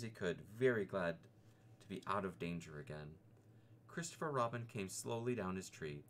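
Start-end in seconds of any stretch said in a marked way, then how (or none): none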